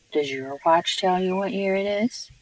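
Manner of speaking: ordinary delivery, nothing marked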